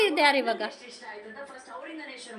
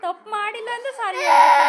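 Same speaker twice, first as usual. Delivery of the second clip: sobbing and shouting in protest; crying shout; 3 semitones higher, 5 dB louder than usual. Real, not acted. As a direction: yell it through real tears.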